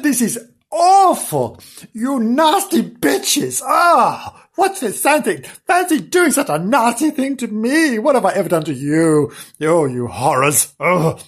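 howling outraged dog; howling protest. Acted. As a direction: wail it like a soaped dog. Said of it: "How he howled".

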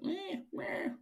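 a high moany bird call; moany pigeon noise. Retold or imitated. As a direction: imitate a grumbling pigeon. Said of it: "moany, grumbly noise".

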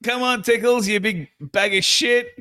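high-pitched mock taunting; goading call, pitched far up; imitating heckling. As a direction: rib a big teammate from the sideline. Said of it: "He was just giving it to him the whole time".

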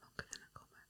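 a mocking whispered echo. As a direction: whisper it back teasingly. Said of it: "mocking repeat".

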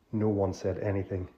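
ordinary delivery

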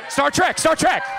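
high, loud repeated shout; urgent, high-pitched interjection; shouted correction. Real, not acted. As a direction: yell it over the other person. Shouting a correction over co-host.

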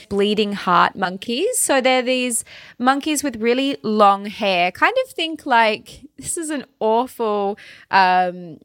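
normal talking voice

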